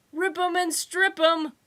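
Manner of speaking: high, loud gleeful shout. A narrator's reading. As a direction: yell it over the shop. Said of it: "Pyle shouted".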